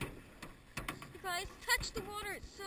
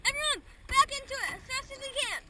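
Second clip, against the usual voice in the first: loud, fast and higher shout; urgent shout; 6 semitones higher, 7 dB louder, faster than usual. Real, not acted. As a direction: rush them, shouting. Urging paddlers to go faster.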